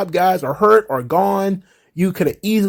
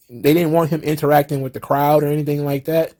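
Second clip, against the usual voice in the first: ordinary talk, nothing marked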